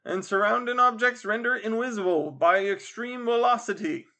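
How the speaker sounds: high, loud street sales patter; boasting coachman. Acted. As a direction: boast loudly to win the fare. Second coachman boasts of speed.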